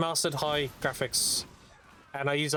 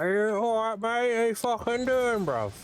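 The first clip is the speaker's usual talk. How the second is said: mock British accent; 8 semitones higher than usual. Streamer denying he talks like this, mock high London accent. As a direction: play a cocky British lad, pitched up. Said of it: "I don't walk around like".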